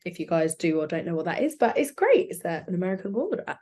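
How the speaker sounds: usual speaking voice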